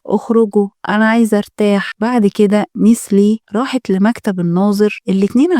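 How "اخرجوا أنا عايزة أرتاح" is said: usual speaking voice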